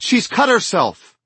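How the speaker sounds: high, loud, slow alarmed cry. Acted. Rachel crying out to Louis about Ellie's cut, alarmed. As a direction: shout the news in a panic.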